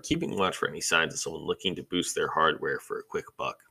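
plain reading voice

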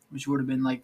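normal talking voice

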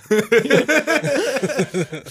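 high burst of laughter; real high laughter; laughing. Real, not acted. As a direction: crack up. Laughs at his own story.

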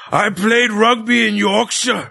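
hammed-up Yorkshire accent, high and slow through a laugh; a bad Yorkshire rugby hard man boast, laughing; terrible Yorkshire accent. Cohost mocking a wrestler from Yorkshire, hammed-up Yorkshire accent. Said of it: "Sorry for the terrible Yorkshire, uh, accent".

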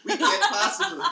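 high, loud laugh swallowing the words; laughing through words. Real, not acted. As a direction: collapse into giggles on the line. Cracks up reading the line.

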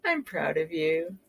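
soft, low, tender reassurance. Acted. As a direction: say it gently to comfort. Reassuring the listener.

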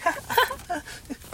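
very high imitated giggle; high squeaky giggle; nervous giggle. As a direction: giggle like an awkward teen. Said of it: "like, giggling".

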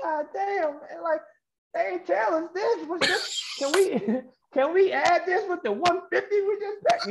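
high mock-outraged griping, a laugh breaking in; exasperated complaint. Retelling his reaction to the twenty-dollar parking fee, high mock outrage.

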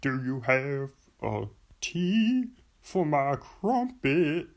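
slow, badly faked British accent; Charles Barkley. Sports celebrity asking for tea, slow mock British accent. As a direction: labour through a celebrity's clumsy British accent. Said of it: "Charles Barkley doing a British accent".

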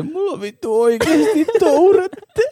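high, slow, laughing mock voice; mock friend voice. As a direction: ham up the friend's odd line, slow and high. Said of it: "Ja sit se".

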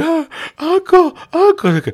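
high, strained cry of pain. Retold or imitated. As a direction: wail uncle in agony. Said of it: "Dude is like".

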